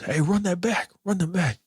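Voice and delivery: high-pitched mock yell; imitated yelling. Embarrassed brother yelling at the coach, high mock yell. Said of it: "he's yelling at the coach".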